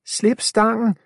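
high shrieking command; screaming. A narrator's reading. Girl screaming at Milo to drop the pole. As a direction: scream at him to let go. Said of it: "skriger Afia".

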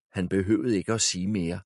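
plain reading voice

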